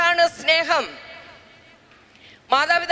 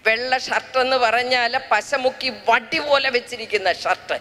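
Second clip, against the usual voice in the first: ordinary delivery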